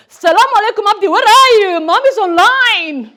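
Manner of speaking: hammed-up sheikh calling her son; mock sheikh voice. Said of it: "filter that makes me look like a sheikh".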